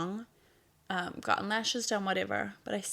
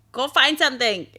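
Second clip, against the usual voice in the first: raised, higher parental insistence; exasperated urging; 5 semitones higher, 13 dB louder than usual. Retold or imitated. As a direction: loudly push the kids to do it. Retold exasperated lecture to kids.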